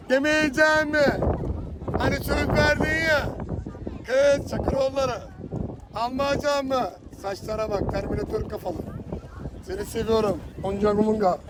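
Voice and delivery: singsong hawker's patter; street vendor call. Acted. Simit seller teasing passersby, playful hawking. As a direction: tease customers into buying.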